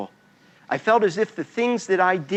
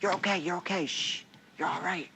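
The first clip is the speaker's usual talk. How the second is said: soft soothing whisper, much softer; hushing whisper; 12 dB quieter than usual. Retold or imitated. Boy whispering to his winded sister so Mom won't hear. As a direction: hush her like a scared kid would. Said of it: "I'm like".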